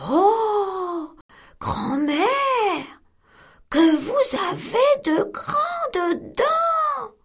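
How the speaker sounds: put-on girl staring at granny in bed; wondering little girl. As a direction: marvel like a puzzled little girl. Girl astonished.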